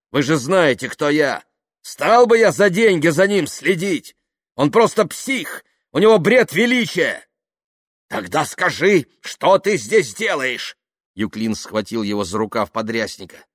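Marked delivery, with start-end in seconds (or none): 0.1-7.3 loud, high, heated protest; indignant. Acted. Kaftanov telling Dasha that Yuklin is crazy, indignant. 8.1-10.8 loud, high, slow challenge; angry demand. Acted. Yuklin challenging Kaftanov to explain himself, angry demand.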